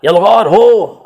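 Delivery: लंबी गूंजती शाही ललकार, ऊँची और ज़ोरदार; Akbar's war cry. Retold or imitated. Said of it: "आपने मुग़ल आज़म में सुना होगा पृथ्वीराज कपूर साहब के मुंह से". (मुग़ल-ए-आज़म के अकबर की नकल में ललकार)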